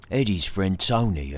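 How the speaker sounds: ordinary delivery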